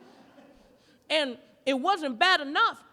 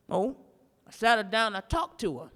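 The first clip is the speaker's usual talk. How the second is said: usual speaking voice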